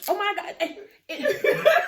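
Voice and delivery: high, squealing put-on reaction, breaking into laughter; over-the-top mock gushing. Imitating someone answering her simple hello, gushing. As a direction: gush it high and loud. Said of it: "Why are you acting like that?".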